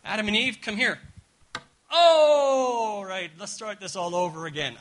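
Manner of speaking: high, loud scolding call; mock scolding God. Preacher voicing a scolding God summoning Adam and Eve. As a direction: scold them like a fed-up parent. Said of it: "not about God saying".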